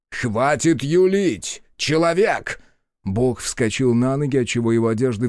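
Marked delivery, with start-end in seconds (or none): 0.1-2.6 angry rebuke. Acted. God loses patience.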